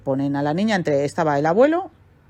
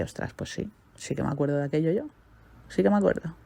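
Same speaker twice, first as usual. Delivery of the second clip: hushed, almost breathless whisper of memory; whispered realisation; 7 dB quieter than usual. Retold or imitated. The client suddenly remembering during therapy, whispering. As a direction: breathe it out as it comes back. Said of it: "llega ese momento y dice".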